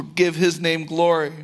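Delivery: ordinary talk, nothing marked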